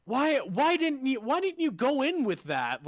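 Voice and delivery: high, loud exasperated question; mock scolding question. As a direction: throw it at them, exasperated.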